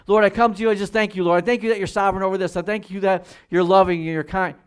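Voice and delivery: high, loud praise prayer; fervent prayer demo. Shown as a demonstration. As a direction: pour out thanks to the Lord with full force. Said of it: "So watch first".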